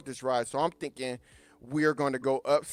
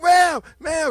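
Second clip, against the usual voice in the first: loud high call after her; 12 semitones higher, 8 dB louder than usual. Retold or imitated. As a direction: call to a stranger. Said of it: "everybody was like".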